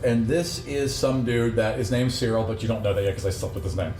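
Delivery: ordinary talk, nothing marked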